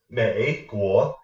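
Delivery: MAY dips and rises, then a separate rising GUO; broken-up word. Shown as a demonstration. Teacher showing learners the choppy version, syllables separated. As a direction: split "May" from "Guo", two full tones. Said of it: "it sounds like you're breaking up the word".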